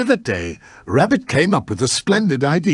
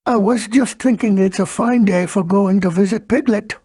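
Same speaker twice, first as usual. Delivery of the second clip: higher-pitched storybook bear voice; Pooh; 7 semitones higher than usual. Acted. Bear tells the tiger of visiting a friend, higher character voice. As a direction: play a cheerful bear, pitched up. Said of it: "said Pooh".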